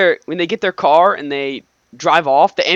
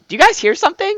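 mock innocent question; 13 semitones higher, faster than usual. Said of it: "they're like".

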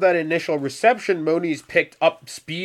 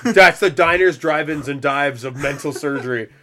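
ordinary talk, nothing marked

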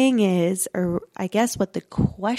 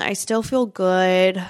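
normal talking voice

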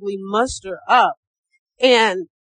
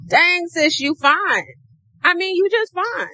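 hyped-up compliment; 7 semitones higher than usual. Pretend. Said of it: "I want you to be like".

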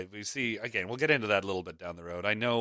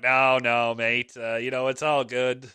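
put-on laid-back English accent, easygoing; relaxed English bloke saying no worries; 3 semitones higher, 5 dB louder, slower than usual. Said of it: "sorry about the English accent there".

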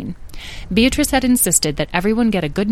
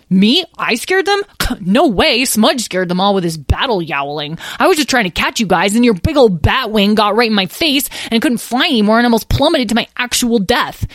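put-on loud, high outraged child; indignant protest; 5 semitones higher, 5 dB louder than usual. Lyle defends himself against his brother's blame, indignant. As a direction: protest and pile up excuses dramatically.

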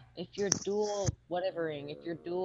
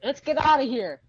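in-character order; 6 semitones higher, 8 dB louder than usual. Acted. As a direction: bark the order to your companion. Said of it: "I say".

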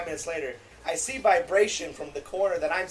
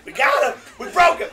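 high, loud gleeful yell; triumphant shout; 11 semitones higher, 11 dB louder than usual. Real, not acted. Friends cheering that they broke him, loud gleeful shout. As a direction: crow in victory.

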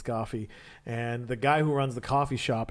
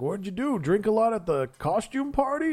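higher-pitched incredulous question; lightly voiced homeless man; 8 semitones higher than usual. Homeless man asking Peter about costume party, lightly mock incredulous. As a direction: lightly imitate a ribbing stranger.

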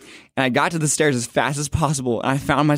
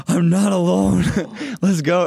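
breathless happy cry, laughing; overjoyed relief; 7 semitones higher, slower than usual. Retold or imitated. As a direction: burst out laughing with joy at not being alone. Friend joins him at altar.

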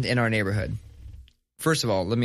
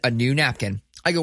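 ordinary delivery

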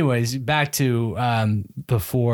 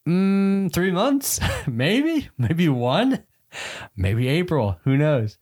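high, halting, laughing hedge; sheepish hopeful guess; 7 semitones higher, much slower than usual. Retold or imitated. Husband retelling his hopeful answer to wife, high and sheepish. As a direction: dodge the question with a nervous laugh. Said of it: "And I'm like".

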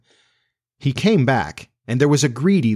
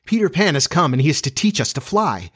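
high, breaking-the-news excitement; excited cry; 6 semitones higher than usual. Acted. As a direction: announce it eagerly in a raised pitch. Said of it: "she cried".

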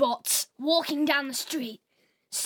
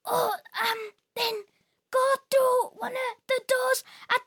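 put-on high, shaky old robot, rising to a shout; 9 semitones higher than usual. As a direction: creak it out, then cry out the warning. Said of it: "another very old robot".

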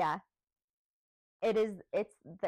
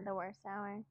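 normal talking voice

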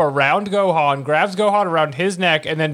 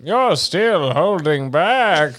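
put-on slow, sexy British accent; much slower than usual. Cohost doing Buu's line in a slow British accent. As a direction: drawl it in a posh British accent. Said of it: "you wanna do it in a British voice, [name]".

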